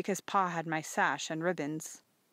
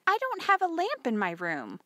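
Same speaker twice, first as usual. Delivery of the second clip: put-on high girlish protest; protesting daughter; 10 semitones higher, 3 dB louder than usual. A narrator's reading. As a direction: object in a child's raised pitch. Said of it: "I protested".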